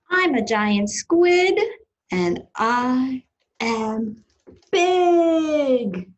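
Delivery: big, slow, loud brag; boastful squid. Acted. Reader as giant squid bragging to children, boastful. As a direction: proclaim it, drawing out each word.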